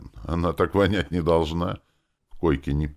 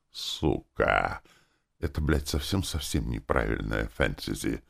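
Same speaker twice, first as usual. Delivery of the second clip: disgusted mutter; 5 semitones lower, 5 dB quieter than usual. Real, not acted. Narrator's aside, disgusted.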